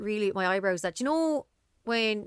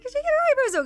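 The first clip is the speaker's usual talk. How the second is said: rising in mock shock, louder; startled question, pitched up high; surprised; 6 dB louder than usual. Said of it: "I was like".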